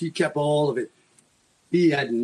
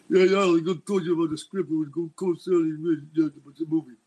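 lightly voiced Sylvester Stallone, quick and mumbly near the end; Stallone impression; faster than usual. A host mimics Stallone pitching his script, lightly voiced. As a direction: imitate Stallone's casual movie-star chatter. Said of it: "this is how he talked".

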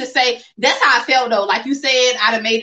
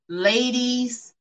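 normal talking voice

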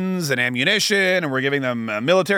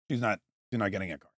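much softer, fast throwaway mumble; dad muttering a quick aside about his daughter; muttered aside; 8 semitones lower, 11 dB quieter, much faster than usual. Real, not acted. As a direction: toss it off under your breath, quick. Muttered aside after the joke.

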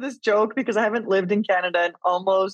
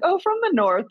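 breezy answer; 11 semitones higher than usual. Retold or imitated. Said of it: "And I'm like".

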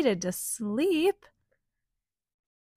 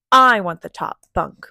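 louder, demanding shout; 11 dB louder, faster than usual. Acted. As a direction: shout for the top bunk. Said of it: "one shouted".

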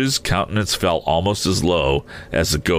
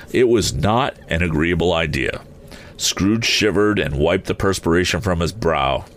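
ordinary delivery

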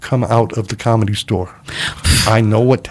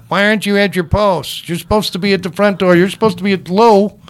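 scolding; 8 semitones higher than usual. Retold or imitated. Boss scolding doorman in retold scene.

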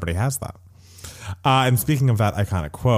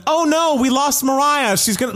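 Billy Eichner impression; 14 semitones higher than usual. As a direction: squeal it out in a frantic pitch. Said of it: "Billy Eichner being like".

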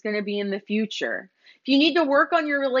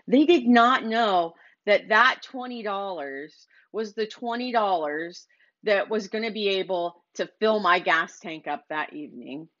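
ordinary talk, nothing marked

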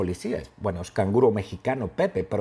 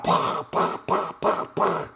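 voiceless popping gun noises; pop-pop shots with the mouth; mimed gunshots; 4 semitones higher, slower than usual. Acted. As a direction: imitate rapid toy gunfire. Imitating gunfire in story.

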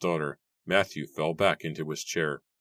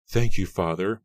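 happy; 3 semitones higher, 7 dB louder than usual. A narrator's reading. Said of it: "Mary cried happily".

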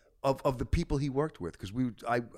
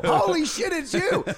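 high, loud starstruck yell; retold fan freak-out at full volume; excited screaming; 9 dB louder than usual. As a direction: scream it like a fan meeting an idol. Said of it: "I'm screaming".

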